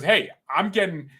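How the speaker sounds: ordinary talk, nothing marked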